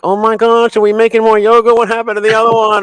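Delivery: high, quick exclamation of disbelief; mock surprise. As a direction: play it as caught off guard at the stairs. Finding yogurt being made again.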